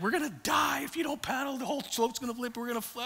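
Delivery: high-pitched mock alarm; frantic warning. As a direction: imitate a guide warning of disaster. Frantic guide warning the rafters.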